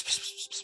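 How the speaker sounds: unvoiced hissing whisper sounds; whispering pss. Shown as a demonstration. GM imitating two figures whispering, soft pss sounds.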